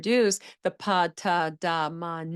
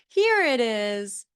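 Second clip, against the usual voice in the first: slow, stretched "Here it is" with a lift on "Here"; slow model phrase; 3 semitones higher, 5 dB louder, slower than usual. Shown as a demonstration. Therapist modeling a phrase to a child, slow and stretched. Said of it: "So always saying".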